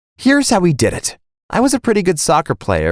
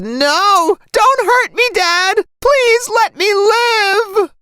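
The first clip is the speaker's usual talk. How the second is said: very high, pleading scream; hammed-up high-pitched panic; feigned terrified scream; much slower than usual. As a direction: scream and beg as if for your life. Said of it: "I screamed".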